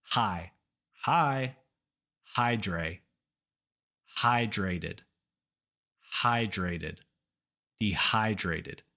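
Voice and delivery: Hi, Hydra, Hydrated, Dehydrated, stress always on HY; low falling models with pauses, building to de-HY-drated; word build-up drill. Shown as a demonstration. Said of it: "start with the part that's hard".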